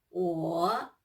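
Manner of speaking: 我 said low, dipping third tone. Shown as a demonstration. Teacher models 我 with a low dipping tone for students. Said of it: "third tone".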